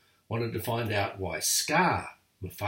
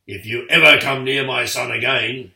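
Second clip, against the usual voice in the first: much louder furious threat; yelling; 11 dB louder than usual. A narrator's reading. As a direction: roar it at the hyenas. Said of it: "he yelled".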